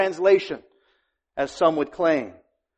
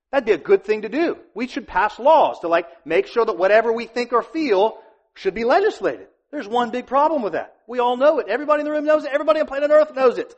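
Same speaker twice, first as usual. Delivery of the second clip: rising preaching; 7 semitones higher than usual. Acted. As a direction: pitch climbs higher and higher, faster at the end. Preacher building to climax.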